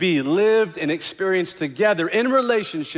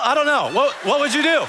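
ordinary delivery

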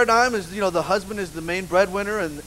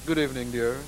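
low, smooth butler-like husband greeting; suave husband; 8 semitones lower, 6 dB quieter than usual. Retold or imitated. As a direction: greet her like a gallant husband. Husband voiced in story.

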